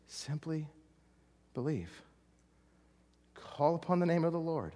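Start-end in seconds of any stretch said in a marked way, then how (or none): 0.1-1.9 soft. Real, not acted. Softened repeat of appeal.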